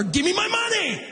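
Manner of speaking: high-pitched mock demand; psyched-up mad. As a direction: burst in with a hammed-up angry demand. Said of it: "so that you are mad at it".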